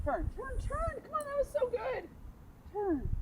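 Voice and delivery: quiet high coaxing; begging the ball to turn; pleading with the ball. Real, not acted.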